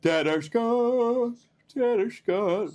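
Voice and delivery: slow, stretched, high muttering while typing; sing-song typing the name; drawn out. Real, not acted. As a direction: drag the name out as you type it.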